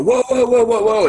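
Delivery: high, loud rapid whoas; alarmed bystander's whoas. Acted. Game master voices someone outside reacting to the gunshot, high alarmed shout. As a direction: call out like a startled onlooker. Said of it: "you hear someone outside go".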